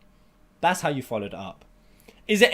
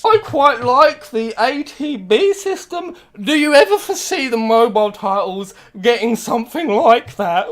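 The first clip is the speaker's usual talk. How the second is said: mocking fawning interviewer; 8 semitones higher, 8 dB louder than usual.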